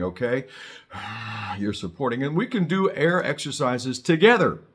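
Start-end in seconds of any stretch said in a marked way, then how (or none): 0.5-1.5 long steady breath pushed out from the stomach; supported breath exhale. Shown as a demonstration. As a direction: blow the air out steadily from the stomach muscles. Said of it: "they wanna sound like this if you're supporting".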